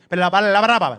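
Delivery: loud, insistent babbling gibberish. Retold or imitated. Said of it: "And my son louder goes".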